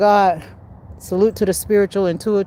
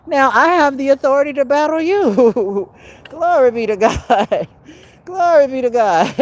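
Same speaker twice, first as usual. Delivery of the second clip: high gleeful laughing praise; triumphant laughter; 6 semitones higher than usual. Real, not acted. To those who wanted battle: gleeful triumphant laughing. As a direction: laugh in victory over your enemies.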